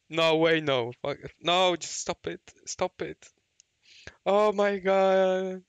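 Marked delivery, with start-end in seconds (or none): none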